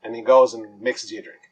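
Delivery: ordinary delivery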